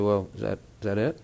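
tired husband's low reply to his wife; quiet reply. Retold or imitated. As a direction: answer her meekly, barely audible.

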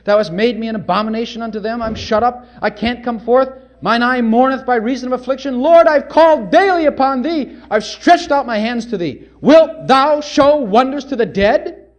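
fervent scripture lament, building; psalm reading. Acted. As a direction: rise to a desperate cry to the Lord.

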